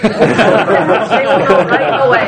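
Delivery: drawn out through laughter; slow, loud and laughing. Real, not acted. Laughing joke about accent.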